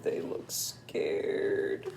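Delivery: slow, low, breathy whisper; low hushed scared whisper. Shown as a demonstration. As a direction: whisper it as if afraid.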